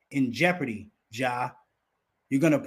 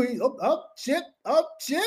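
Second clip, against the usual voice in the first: high, sputtering stammer, lost for words; mock tongue-tied sputter; stammering Skip; 9 semitones higher than usual. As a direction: mimic a flustered man who can't get a word out. Mimicking Skip's stammer.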